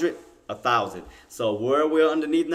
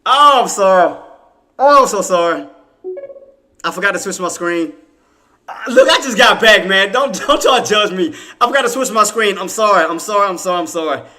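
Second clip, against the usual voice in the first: loud, high, embarrassed apologizing through laughs; real laughing embarrassment; flustered apology; 7 semitones higher, 12 dB louder than usual. Real, not acted. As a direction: apologize over and over, mortified but laughing.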